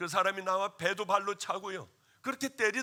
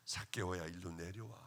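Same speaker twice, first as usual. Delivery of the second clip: soft, low calling voice, tender; calling him down kindly, softer; gentle call; 10 semitones lower, 7 dB quieter than usual. Retold or imitated. Pastor voices Jesus calling Zacchaeus from tree, soft tender call.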